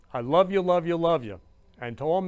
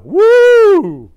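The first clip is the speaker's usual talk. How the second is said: loud, high-pitched sustained whoo; piercing, drawn-out cheer; emotional whoop; 16 dB louder than usual. Real, not acted. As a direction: let the feeling out in one big whoop. Releases emotion after mom surprise.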